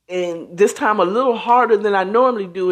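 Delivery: ordinary delivery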